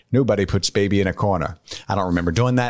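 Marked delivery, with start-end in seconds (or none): 0.1-1.6 lightly voiced Patrick Stewart; Patrick Stewart impression. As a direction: quote it as Patrick Stewart would. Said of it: "one of you impersonating Patrick Stewart saying".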